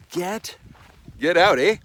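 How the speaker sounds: punning shout of "get out, eh?"; a loud, cheeky "get out, eh?"; mock exclamation. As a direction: blurt "get out, eh?" as a loud joke. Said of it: "Sorry, couldn't help it".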